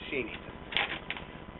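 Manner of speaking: slow, unvoiced sarcastic whisper; sarcastic hushed aside; mock whisper. Real, not acted. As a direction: breathe it out with scorn. Mocking the donation letter.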